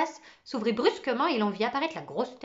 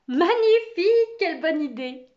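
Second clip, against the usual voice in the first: exclamation aiguë et forte, premier mot étiré; félicite avec un grand élan; enthousiasme; 8 semitones higher, 11 dB louder than usual. Acted. Maîtresse enthousiasmée par une réponse. (enthousiasme éclatant de maîtresse)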